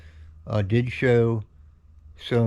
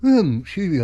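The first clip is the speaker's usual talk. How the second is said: yawning; 8 semitones higher, 4 dB louder than usual. Real, not acted. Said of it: "I'm tired, really tired".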